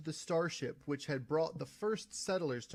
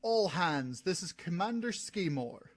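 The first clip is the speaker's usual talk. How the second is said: loud ship-wide call, higher; ringing announcement; 4 semitones higher, 5 dB louder than usual. Acted. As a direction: address the whole crew over the intercom. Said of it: "voice rang out over comm".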